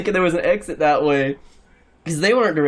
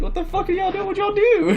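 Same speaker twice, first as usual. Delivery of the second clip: hammed-up alarmed questioning; mock panicked disbelief. Getaway driver to friends sprinting back, mock high panic.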